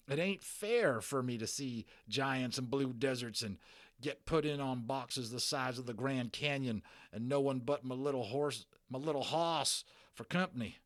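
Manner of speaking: a lonely cowboy near tears; self-pitying Texas cowboy. Acted. Cowboy complaining to the boy he has only his horse.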